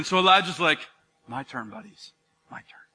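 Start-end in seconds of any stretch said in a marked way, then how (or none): 1.3-2.8 quiet confidence. Acted. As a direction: say it like you already won. Elijah calmly claiming his turn.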